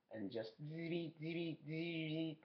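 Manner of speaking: slow soft buzzing insect noise; mosquito impression. Shown as a demonstration. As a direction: buzz like a mosquito. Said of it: "That's my mosquito impression".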